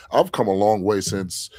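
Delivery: normal talking voice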